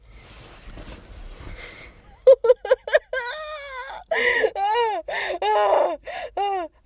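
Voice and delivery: high, loud half-laughing, half-crying shriek; panicked laughing scream. Real, not acted. After the roach scare, a shrieking laugh-cry.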